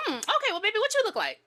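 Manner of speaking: high, loud phone-flirt voice; flirty caller. Acted. Woman on Watson's phone asking his looks, high flirty voice. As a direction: play a caller sizing up a stranger.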